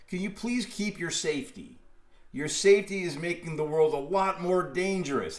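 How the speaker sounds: ordinary talk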